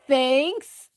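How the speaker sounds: imitating a polite, unconvinced thanks; drawn-out thanks that rises at the end; unenthusiastic thanks. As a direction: act out feigned gratitude for an unwanted gift. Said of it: "He would hate it".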